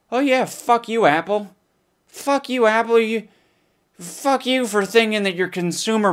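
high, hammed-up rant at Apple; mock outrage. Real, not acted. To Apple, over the hidden shortcut, hammed-up high rant. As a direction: yell it up high in fake fury.

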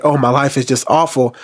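ordinary talk

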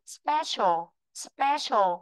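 "special" ending in a clear "sho"; "special" said carefully with "sh-ô" at the end; demonstrated pronunciation. Shown as a demonstration. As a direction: model "special" for a learner, sh sound in the last syllable. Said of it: "Từ này là chữ sơ, sô này".

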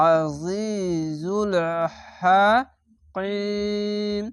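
long-drawn Quranic chant; very slow recitation. Shown as a demonstration. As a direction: stretch আজিজুল হাকিম long, voicing the হা from the throat. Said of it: "এই হা, এরকম এই সাউন্ডটি প্রথমে বের করবেন".

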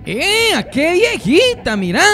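mocking high cheer. Onlooker mocking an old lady's dancing, high teasing hoot. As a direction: hoot at her like a gleeful onlooker.

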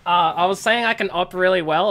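normal talking voice

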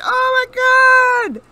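slow, loud and high disbelief; loud drawn-out oh my god. Real, not acted. Gamer amazed at the round, slow and loud. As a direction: stretch it out in shock.

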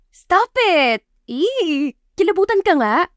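high, squealing mock disgust; grossed-out. Jerilyn scolding Anne's crush on the boss, high grossed-out squeal.

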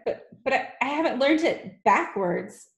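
high-voiced nervous stammer; Wilfred. A narrator's reading. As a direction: stumble over the opening words anxiously. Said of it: "stammered Wilfred".

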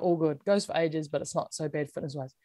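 ordinary delivery

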